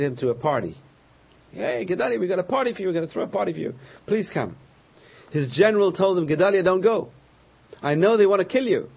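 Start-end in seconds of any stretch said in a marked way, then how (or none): 1.5-4.6 lightly hammed-up, higher-pitched invite; mock friendly invitation. Plotters invite Gedalia to a party. 6.3-8.9 louder, higher retold plea; urgent warning. General warns Gedalia of murder plot.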